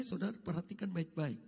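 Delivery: usual speaking voice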